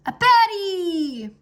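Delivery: slow, high, loud spell word; spell cry. Acted. Wizard dragon opening the Naga's door, booming spell cry. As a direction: boom the final word of the spell.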